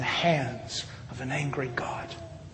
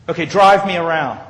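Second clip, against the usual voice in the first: louder imitated policeman's order; retold curt order; 8 dB louder than usual. Corrupt policeman giving an order.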